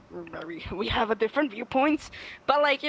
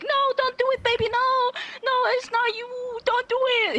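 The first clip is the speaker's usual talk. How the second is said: much higher, pleading mock panic; mock panicked partner. Imitating a panicking partner, high frantic pleading. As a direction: plead frantically, over the top. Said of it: "he wouldn't be like".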